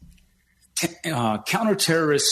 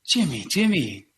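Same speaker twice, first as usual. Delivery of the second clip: much higher, beaming greeting; Nicola on phone; 10 semitones higher than usual. Retold or imitated. CEO answering the call to Jimmy, high cheerful voice. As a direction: greet him like a long-lost friend.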